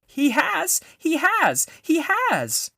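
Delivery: very high, loud squeaking cry; a baby squirrel losing its head; squeaked. A narrator's reading. Young squirrel shouting at the witch, high squeaky cry. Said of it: "it squeaked".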